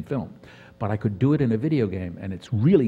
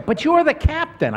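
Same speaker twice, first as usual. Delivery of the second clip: high, loud mock-frightened protest; imitating own high-pitched pleading; retold protest; 6 dB louder than usual. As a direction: squeak out an objection, pitched way up. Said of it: "And I say".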